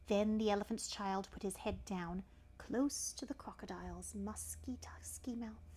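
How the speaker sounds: plain reading voice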